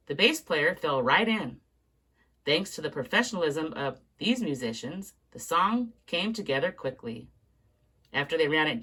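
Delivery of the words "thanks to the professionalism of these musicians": usual speaking voice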